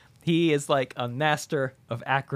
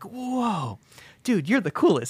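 higher amazed fan voice; awed Rod; 8 semitones higher than usual. Retold or imitated. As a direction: gasp at the cool trick. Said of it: "He's like".

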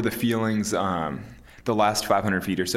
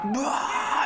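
high, sudden triumphant whoop, imitating a climber at the top; imitated ballistic yell. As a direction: let out an ecstatic "Ah!" as if fired up beyond control. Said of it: "he just goes ballistic".

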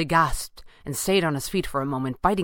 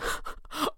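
acted breathless chuckling; high, wheezy villain laugh; convulsing laughter; 14 semitones higher, 7 dB quieter than usual. A narrator's reading. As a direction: keep laughing helplessly. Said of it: "still convulsing".

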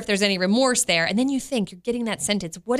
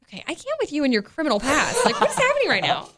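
laughing disbelief; 4 semitones higher than usual. Real, not acted. Teasing friend's criminal past.

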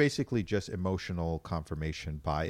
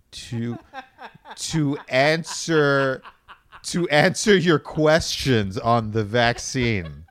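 louder, slow mock correction; exasperated official; 3 semitones higher, 6 dB louder, slower than usual. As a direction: spell it out like a fed-up press office.

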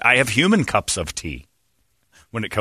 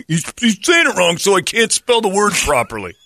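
high-pitched, indignant hillbilly rant; 8 semitones higher, 3 dB louder than usual. Pretend. Acting outraged speller mocking hillbilly.

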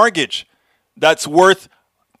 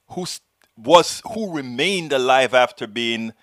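ordinary delivery

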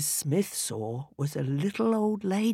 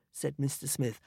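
usual speaking voice

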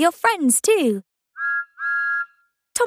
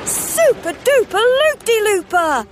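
high, loud and drawn-out cheer; excited shout; 11 semitones higher, 3 dB louder than usual. Acted. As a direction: whoop it up on the ride. Thomas riding coaster.

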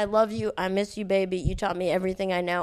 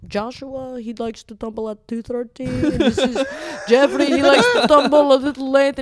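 imitated Turkish accent, breaking into laughter and getting louder; 3 semitones higher, 6 dB louder than usual. Coworker introducing his pigeons by name, in mock Turkish accent. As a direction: mimic an earnest Turkish coworker, cracking up midway. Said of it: "Do it, do it, the accent".

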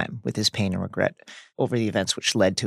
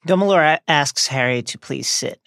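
ordinary delivery

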